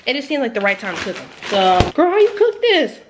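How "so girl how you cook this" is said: baffled. Real, not acted. Baffled by vegetables.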